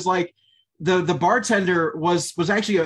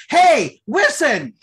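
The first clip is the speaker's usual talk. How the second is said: loud, raised mock bartender shout; calling for attention; 7 semitones higher, 6 dB louder, much slower than usual. Bartender interrupts fairies who won't stop talking, calling out loudly. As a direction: cut through the chatter with a high, loud call.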